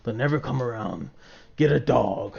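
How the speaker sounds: put-on a tired adult shutting down a kid's fantasy, quietly; flat grown-up voice. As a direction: sound deflated, like a grown-up killing the dream. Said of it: "it's like".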